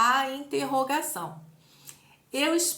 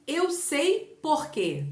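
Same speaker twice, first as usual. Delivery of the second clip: slow exclamation demo; 4 semitones higher, much slower than usual. Shown as a demonstration. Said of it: "é uma exclamação".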